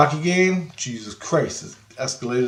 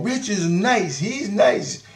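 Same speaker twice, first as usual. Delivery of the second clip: put-on high, childlike sing-song; kids' voices; 9 semitones higher, much slower than usual. His kids defending him to the girls, childlike high voice. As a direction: pitch it up like a little kid defending dad. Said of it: "My kids are going".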